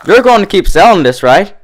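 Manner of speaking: high, loud hopeful question; eager tipsy. A narrator's reading. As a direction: plead like a happy drunk. Drunk Dash excited.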